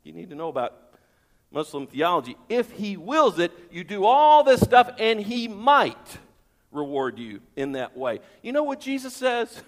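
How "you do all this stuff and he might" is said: loud, drawn-out build to "might"; raised, forceful voice stretching "all" and "might"; emphatic stress. Real, not acted. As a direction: pile it up, then land hard on "might". Stressing the uncertainty of reward.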